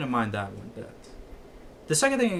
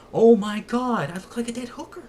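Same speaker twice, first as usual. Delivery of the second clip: mock dismay, much higher, loud at first; imitating a zombie appalled at his reflection; horrified exclaim; 11 semitones higher, 7 dB louder than usual. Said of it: "he goes".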